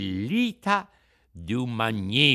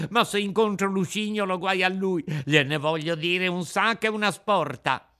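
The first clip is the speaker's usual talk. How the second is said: high puppet voice, remorseful then indignant; a sorry puppet working himself up to anger; Pinocchio lamenting; 6 semitones higher than usual. A narrator's reading.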